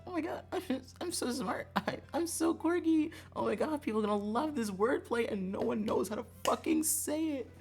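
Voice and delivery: high, gushing squeal building into a loud wail; mock self-congratulation. Streamer mocking past self for choosing a clever name, high mock gushing. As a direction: gush giddily, then cry out in high dismay.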